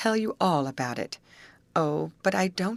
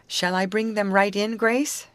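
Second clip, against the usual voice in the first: raised voice called over the shoulder, pitched high; 6 semitones higher, 4 dB louder than usual. A narrator's reading. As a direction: call back across the room to Grace. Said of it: "she called over her shoulder".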